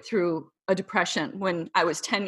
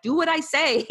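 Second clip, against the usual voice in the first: bossy command; 8 semitones higher than usual. Retold or imitated. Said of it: "I'm like".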